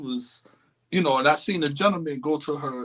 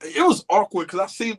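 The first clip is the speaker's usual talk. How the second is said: usual speaking voice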